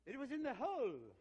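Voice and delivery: loud, high punchline with a laugh; triumphant reveal. Retold or imitated. Pastor revealing the ace to congregation, loud and triumphant. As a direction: land the punchline big and amazed.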